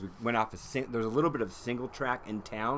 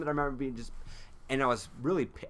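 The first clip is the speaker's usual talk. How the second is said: ordinary delivery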